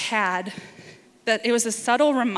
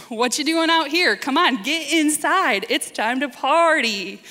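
louder, higher call to get inside; calling out loudly; 5 semitones higher, 4 dB louder than usual. Pretend. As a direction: hail the son from the doorway, brusque. Hypothetical father calling son in.

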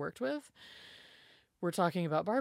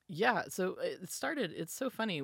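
ordinary delivery

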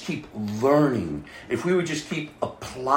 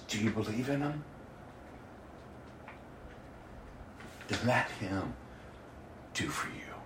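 hushed and moved; 4 semitones lower, 17 dB quieter than usual. Real, not acted. Tearful hushed retelling.

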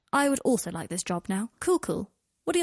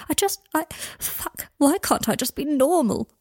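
distressed outburst; 6 semitones higher, 5 dB louder than usual. A narrator's reading. Draco breaking down on phone.

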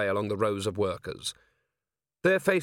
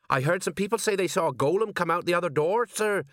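high-pitched, dutiful underling voice; high, respectful sergeant reporting; eager deferential sergeant; 7 semitones higher than usual. A narrator's reading. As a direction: report to the boss, voice pitched well up. Sergeant's character voice.

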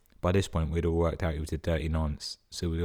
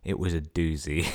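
ordinary talk

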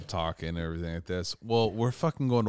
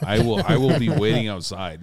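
mock threat; 8 dB louder than usual. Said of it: "No, I was like".

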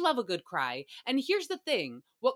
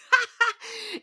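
high laugh; 13 semitones higher, 5 dB louder than usual. Real, not acted. Laughing mid-sentence.

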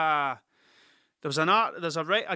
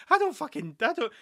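ordinary talk

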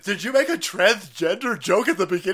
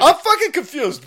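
shouted, pitched way up; loud baffled yell; loud confused outburst; 10 semitones higher, 9 dB louder than usual. Real, not acted. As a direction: blurt it out, baffled. Mock outrage at clip.

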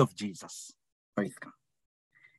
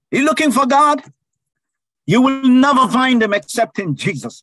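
loud and high, driving it home; rising preacher's cry; fervent preaching shout; 7 semitones higher, 6 dB louder than usual. Real, not acted. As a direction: call out to the whole church. Preacher exhorting the congregation.

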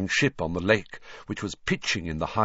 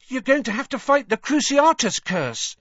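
high teasing voice; a lightly done joking twin, pitched up; lightly voiced mocking twin; 5 dB louder than usual. Fred/George mocking Neville.

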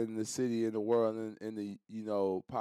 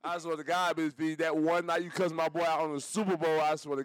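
high, loud mock swearing; mock plea; 7 semitones higher, 5 dB louder than usual. Swearing to Miss P he was not there, mock pleading. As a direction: plead your innocence, hammed up.

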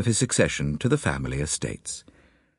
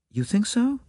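put-on faint American accent; 11 semitones higher than usual. A narrator's reading. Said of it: "faint transatlantic accent".

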